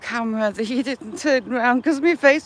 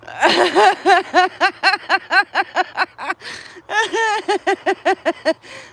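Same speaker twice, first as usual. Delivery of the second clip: long helpless tearful laugh; real tears of laughter; crying with laughter; 3 semitones higher than usual. Real, not acted. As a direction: laugh till tears come. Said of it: "I'm crying".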